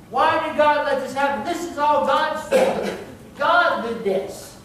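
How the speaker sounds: loud, high, imitated protest; accusing outcry. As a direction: cry out blame as a questioning mourner would. Acts people blaming God after tragedy.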